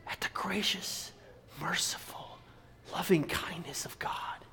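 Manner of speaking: near-whisper, spaced with pauses; hushed awe. Real, not acted. Preacher marvelling at the loving kindness of God, hushed. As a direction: drop to a breath, word by word.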